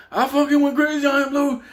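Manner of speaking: high, loud indignant outburst; worked-up protest. Retold or imitated. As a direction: imitate a rattled cellmate venting. Beatty worked up after fight.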